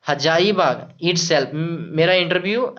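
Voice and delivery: ordinary talk, nothing marked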